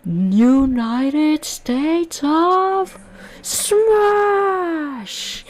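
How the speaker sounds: drawn-out, very high, loud battle cry; big anime attack shout; screamed attack name. Retold or imitated. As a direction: yell the finishing move with everything. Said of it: "he screams out".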